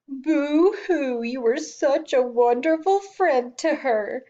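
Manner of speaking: high, slow sobbing parody of a grown man; boo-hoo mockery of an old movie mourner; mocking mushy imitation. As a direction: ham up the fake weeping, sneering. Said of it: "laugh at and imitate later".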